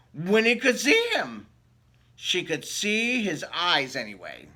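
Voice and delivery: lively read-aloud storybook voice; story narration. Acted. Reading a picture book to children, lively storytelling voice. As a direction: read it like bedtime story time.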